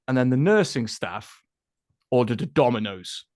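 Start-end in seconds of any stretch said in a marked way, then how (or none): none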